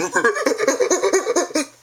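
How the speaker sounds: high, rapid mock laughing noise; goofy mall noise. Shown as a demonstration. Embarrassing his kids in the mall, high silly cackle. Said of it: "walking around middle of the mall going".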